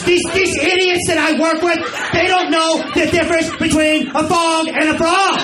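shrill high shouted rant; screeching wife. Retold or imitated. Attendant yelling at her husband at home, shrieking rant. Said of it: "yelled at her husband".

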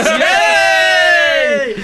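very high, loud yay; cheer. Real, not acted. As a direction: whoop with delight. Cheering pun.

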